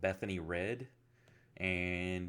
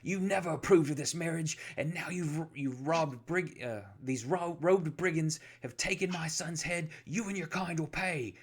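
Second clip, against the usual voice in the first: accusatory; 8 semitones higher than usual. Acted. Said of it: "stabbing an accusatory finger".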